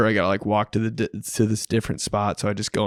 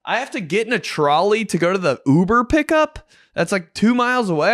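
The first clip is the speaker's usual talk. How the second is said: higher, bewildered tourist whine; mock bewildered traveler, higher voice; confused traveler; 9 semitones higher than usual. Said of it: "I've been this person before".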